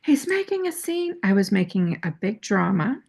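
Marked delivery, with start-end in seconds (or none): 0.0-1.2 much higher, petulant pleading; shrill-pitched mock tantrum; whiny demand. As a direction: play the spoiled girlfriend begging for backup. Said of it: "I'm like".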